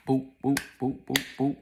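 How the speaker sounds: high, loud staccato boops; rhythmic vocal blows; imitated punches. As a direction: punch out each thud sound separately.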